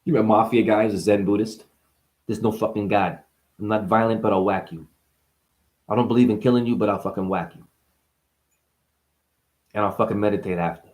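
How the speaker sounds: a hint of a Jersey mob guy; low Jersey gangster mumble; New Jersey mobster. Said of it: "speak like a frickin' guy from Jersey".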